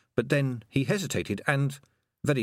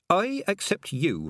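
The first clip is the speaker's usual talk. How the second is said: slow and deliberate; 8 semitones higher, slower than usual. Acted.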